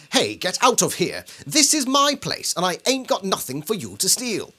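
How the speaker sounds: put-on British accent. Host to wife, rereading the homeless man in British accent. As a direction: bark it out as a gruff Briton. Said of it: "let's read it in a British accent".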